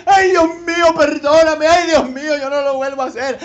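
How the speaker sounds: hammed-up sinner begging forgiveness; mock pleading wail. Believer caught by God's discipline, mock sobbing plea.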